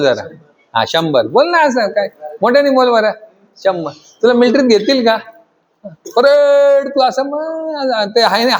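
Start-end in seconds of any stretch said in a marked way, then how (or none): 5.9-8.1 high, drawn-out parade command; mock parade-ground call, pitched up and held long; military drill commander. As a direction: imitate an army drill call, stretching the words. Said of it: "आवाज कसा असतो त्याचा".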